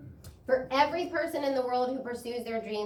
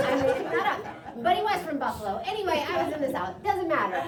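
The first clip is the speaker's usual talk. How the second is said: words said through laughter, fast and higher; talking while cracking up; laughing; 7 semitones higher, 4 dB louder, faster than usual. Real, not acted. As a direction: giggle through the aside. Laughs through his own joke.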